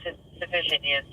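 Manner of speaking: ordinary talk, nothing marked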